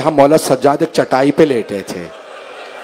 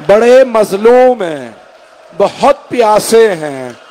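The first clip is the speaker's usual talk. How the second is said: higher, slow grieving cry; wailing plea; 5 semitones higher, slower than usual. Acted. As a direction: plead through tears for the father. Grieving plea, pitch raised.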